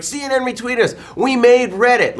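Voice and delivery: high, loud imitation of jubilant kids; mock celebrating. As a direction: crow over going viral, hammed up. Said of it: "they're partying when they're trending on Twitter".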